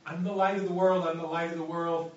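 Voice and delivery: a moderate mock yell repeated twice; retold yelling. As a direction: call it out again and again. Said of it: "and he keeps yelling".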